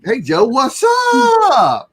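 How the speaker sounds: high, drawn-out holler; playful hollered greeting. Real, not acted. Host greeting co-host, playful drawn-out holler. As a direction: holler it high and stretch the last word long. Exaggerated greeting between co-hosts.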